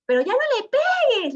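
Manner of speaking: put-on high, loud pleading older woman; pleading neighbor lady. Neighbor lady begging the man to stop kicking, high loud plea. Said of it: "le decía".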